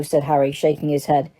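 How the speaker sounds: ordinary delivery, nothing marked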